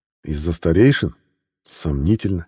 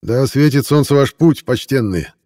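merchant voice; 7 semitones higher, 4 dB louder than usual. Acted. Fawning merchant greets buyers.

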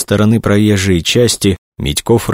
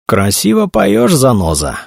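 gang boss voice; 8 semitones higher than usual. A narrator's reading. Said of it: "покачал головой главарь".

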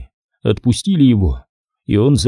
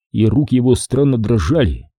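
plain reading voice

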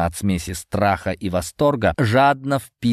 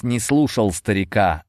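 ordinary delivery

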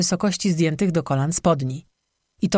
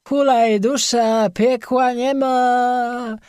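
put-on high, slow, drawn-out drunken wail; drunken howl; 6 semitones higher, much slower than usual. A narrator's reading. Drunk on a tavern stool revels, howling. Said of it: "zawył Chlejus".

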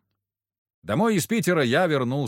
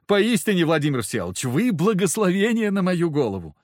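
effusive; 7 semitones higher, 4 dB louder than usual. A narrator's reading. Polzunov effusively grateful.

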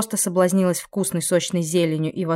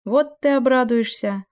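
slow, higher wry remark; wry snort; 6 semitones higher than usual. A narrator's reading. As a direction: tease with a dry snort. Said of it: "хмыкнула в ответ Ниида".

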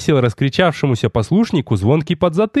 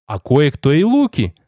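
plain reading voice

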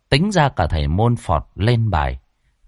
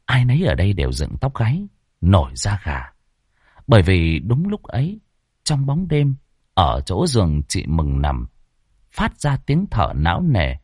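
ordinary delivery

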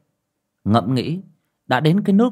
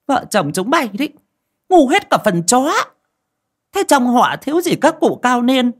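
much higher and louder, berating; angry scolding; 6 dB louder than usual. A narrator's reading. Aunt berating the foolish couple, angry scolding. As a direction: tear into them like a furious elder. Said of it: "mà mắng".

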